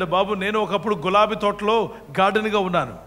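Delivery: normal talking voice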